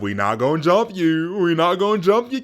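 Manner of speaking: rising taunt. Retold or imitated. Elijah taunting the king, rising loud mock reassurance. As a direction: build the taunt up.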